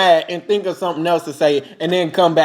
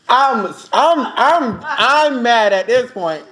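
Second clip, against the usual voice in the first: laughing; 4 semitones higher, much slower than usual. Real, not acted. Laughing while recounting the scene.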